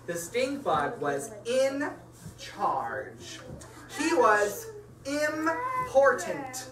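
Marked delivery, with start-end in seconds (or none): 4.0-6.7 pompous. Acted. Mocking the bug's self-importance.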